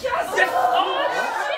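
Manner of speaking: high, loud whoops of joy over a win; excited cheer. Real, not acted. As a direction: yell it out like you just won the quiz.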